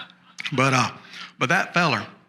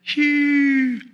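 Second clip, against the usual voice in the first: high, long answering call; imitated holler back; 13 semitones higher, 4 dB louder than usual. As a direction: send back a high, sustained distant holler. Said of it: "That's the holler back".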